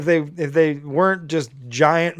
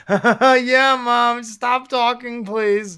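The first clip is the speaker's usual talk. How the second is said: forced laugh into a higher-pitched plea; uncomfortable laugh; 8 semitones higher, much slower than usual. Retold or imitated. Host mimicking a son on air with his mom, uncomfortable laugh. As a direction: imitate an embarrassed son laughing it off. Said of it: "that uncomfortable laugh where he's like".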